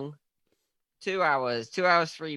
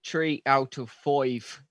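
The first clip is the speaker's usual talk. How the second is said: slow, mock southern Irish lilt; attempted southern Irish, drawn out; southern Irish accent; slower than usual. Shown as a demonstration. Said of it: "I was doing a southern".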